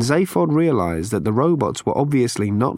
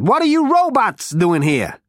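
higher, brash Zaphod voice; 10 semitones higher than usual. A narrator's reading.

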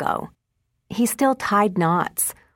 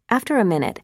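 usual speaking voice

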